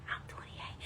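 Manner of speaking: whispered, almost silent twenty-eight; whispered answer. Retold or imitated. Contestant shyly telling the host her age, whispered. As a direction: breathe it out shyly.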